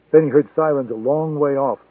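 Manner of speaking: plain reading voice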